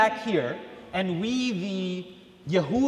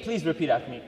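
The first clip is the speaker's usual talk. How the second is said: ordinary talk, nothing marked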